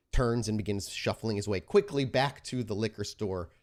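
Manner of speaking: normal talking voice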